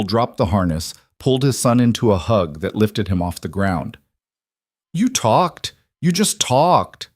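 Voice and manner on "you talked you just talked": overjoyed. A narrator's reading. Father overjoyed son speaks.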